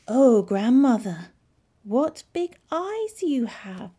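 loud, slow exclamation climbing high; drawn-out storybook amazement; astonished gasp. A narrator's reading. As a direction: marvel in shock, stretching the words. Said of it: "she gasped".

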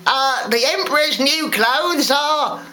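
high, hesitant crowd member; slow, high, unsure praise; timid. A narrator's reading. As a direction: falter timidly, searching for a compliment. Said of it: "said timidly".

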